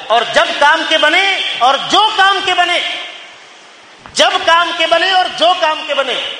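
high, insistent sermon voice; forceful preaching. Acted. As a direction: drive the reproach home from the pulpit. (پرجوش، بلند سُر میں وعظ)

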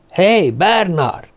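drawn-out, high-pitched call. Acted. Boy calls his hidden friend Bernard, calling out. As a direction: stretch the name and pitch it up.

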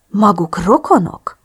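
slow, amazed question; astonished realisation. A narrator's reading. Chris spots the doctor's resemblance to Drew, astonished. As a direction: ask slowly as it dawns on you. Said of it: "hüledeztem a felismeréstől".

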